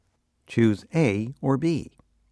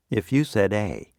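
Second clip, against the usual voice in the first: usual speaking voice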